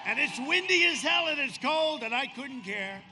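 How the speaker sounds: loud shout into the wind; loud rally cry, hearty and defiant. Real, not acted. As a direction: belt it out over the crowd.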